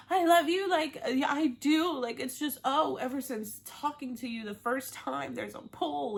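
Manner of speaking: high-pitched, gushing mock confession; laying it on thick. Imitating Natasha declaring love to Tom, laying it on thick. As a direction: gush it up high, hammed-up lovesick. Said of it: "keeps laying it on thick".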